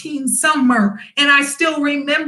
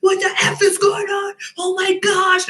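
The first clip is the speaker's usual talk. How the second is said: high, flustered mock panic; panicky friends; 7 semitones higher than usual. Soldier's friends asking what happened, in high mock panic. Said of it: "his friends were like".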